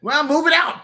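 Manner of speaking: impatient shout. Acted. Impatient ordering the bug.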